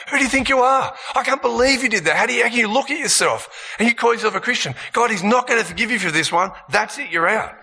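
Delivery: high, fast, outraged accusation; accusing voice. Acted. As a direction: condemn them like a furious judge. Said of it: "There's accusation".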